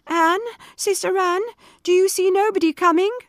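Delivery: high, loud calling upward; anxious call. Acted. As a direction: call up the tower desperately. Wife calls again.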